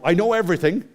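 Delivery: hammed-up boast "I know everything"; mock cocky. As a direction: act like a know-it-all beginner. Mock overconfident programmer voice.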